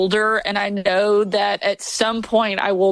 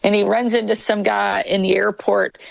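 ordinary talk, nothing marked

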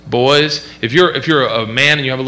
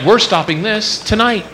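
firm dad; 7 semitones higher than usual. Retold or imitated. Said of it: "I'm like".

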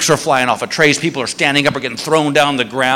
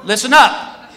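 raised announcer-like guard voice; addressing a crowd; 5 semitones higher than usual. Retold or imitated. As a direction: call out to the whole line. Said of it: "he goes to this whole security line".

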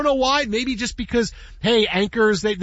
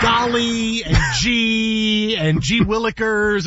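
put-on wholesome 1950s kid; slower than usual. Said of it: "similar terms are".

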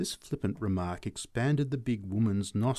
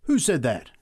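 loud, higher challenge; raised, challenging cry; startled cry; 5 semitones higher, 6 dB louder than usual. A narrator's reading. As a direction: cry it out, demanding who spoke. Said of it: "he cried".

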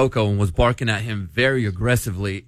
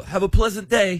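high, lilting put-on British; British alter ego being polite; British accent; 8 semitones higher than usual. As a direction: keep up a mock-British voice. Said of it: "my British alter ego came out".

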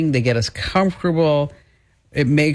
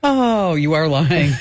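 ordinary talk, nothing marked

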